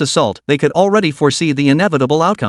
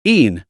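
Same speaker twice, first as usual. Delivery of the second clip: short sharp cry as it charges; a quick fierce cry to attack; angel battle cry; 3 semitones lower than usual. Acted. The archangel cries out and charges the Minotaur, battle cry. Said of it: "let out a cry".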